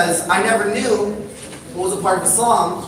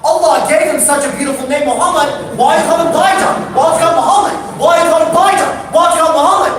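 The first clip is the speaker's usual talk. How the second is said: high, loud, escalating rant; ranting kid; 9 semitones higher, 7 dB louder than usual. Retold or imitated. Confused kid ranting at the brothers, high and loud. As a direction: yell it higher and higher, exasperated. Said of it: "He's like".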